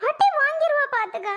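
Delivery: high-pitched, alarmed telling-off; scolding. Acted. Aunt forbidding child's talk of death, scolding. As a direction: scold sharply, pitch jumping well up.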